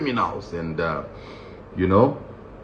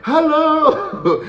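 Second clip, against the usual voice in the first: very high, delighted hey, hello; excited catcall; much slower than usual. Retold or imitated. Comic imitating inmates at the truck window, high excited catcall. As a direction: call out to a passing girl. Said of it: "they're like".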